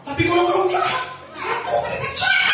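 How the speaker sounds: mocking the revenge motto; mock vengeful taunt. Shown as a demonstration. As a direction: push the pitch way up on jahat. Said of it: "kita akan bilang begini".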